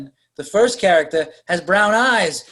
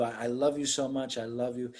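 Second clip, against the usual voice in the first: low, hushed declaration of love; understated lover's pleading, much softer; soft retold plea; 6 semitones lower, 10 dB quieter than usual. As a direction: murmur a mock-romantic plea.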